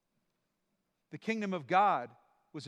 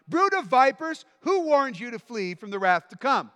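shouting prophet; 6 semitones higher, 8 dB louder than usual. Acted. Preacher voicing John the Baptist.

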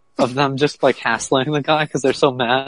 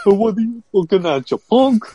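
higher-pitched Bronx tough guy; Bronx accent; 8 semitones higher than usual. Retold or imitated. Imitating a cartoon character looking for trouble, mock Bronx accent. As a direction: pick a fight in a put-on Bronx accent. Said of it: "this, like, Bronx accent".

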